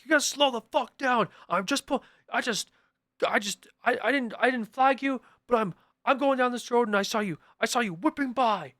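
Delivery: sustained high-pitched rant, imitating an officer; screaming cop. Cop scolding a driver he saw whipping by, screaming. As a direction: keep yelling as the aggressive cop, tripping over words. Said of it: "he starts screaming at me".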